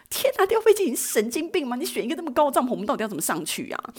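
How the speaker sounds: high-pitched shocked outburst, imitating friends; mock alarm, voice jumping up high; imagined shocked friends. Friends aghast at the tallest tent, high shocked cry. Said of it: "我两个朋友都倒吸一口气".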